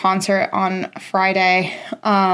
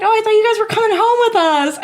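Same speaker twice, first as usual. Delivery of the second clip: high, fast mock friend voice; imitating Sarah; 11 semitones higher, faster than usual. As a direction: rattle it off pitched way up. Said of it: "She was like".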